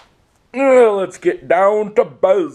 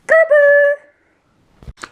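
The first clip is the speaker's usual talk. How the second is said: very high and louder cartoon squeak; squeaky goodbye; 5 dB louder than usual. Signing off the video in a very high squeak. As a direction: chirp it like a tiny critter. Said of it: "try and make voices for stuff".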